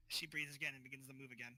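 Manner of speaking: ordinary talk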